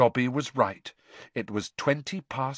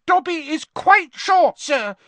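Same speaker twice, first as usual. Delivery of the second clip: high, loud earnest squeak; earnest house elf; 7 dB louder than usual. Acted. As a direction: assure him sincerely. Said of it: "said the elf earnestly".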